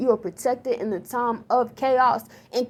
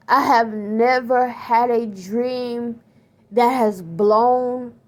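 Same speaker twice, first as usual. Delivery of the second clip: usual speaking voice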